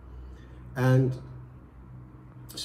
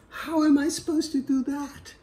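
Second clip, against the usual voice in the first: much higher, disbelieving; incredulous wife; 5 dB louder than usual. Acted. Wife to priest, incredulous. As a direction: she can't believe the advice.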